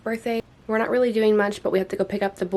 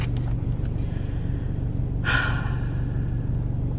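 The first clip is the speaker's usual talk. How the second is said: heavy sigh. Real, not acted. After argument with husband.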